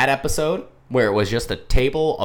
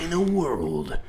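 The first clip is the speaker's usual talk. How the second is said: movie trailer voice; 4 semitones higher, slower than usual. Pretend. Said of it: "the movie trailer guy voice".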